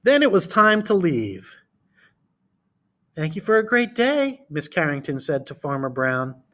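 put-on reading to children. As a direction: read it to a class of small kids. Reads picture book aloud.

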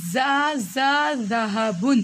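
زا repeated and stretched very slowly; very slow sounding out. Shown as a demonstration. Teacher sounds out the letter for the child, very slowly. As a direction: drag out each syllable for the child. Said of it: "اس کو اب ہم کیا پڑھیں گے".

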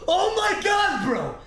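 huge high drawn-out yelled laugh; laughing shout. Real, not acted. Streamer yelling after a near disaster, laughing shout. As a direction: scream it out in shocked relief. Shock after nearly losing progress.

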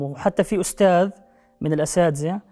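normal talking voice

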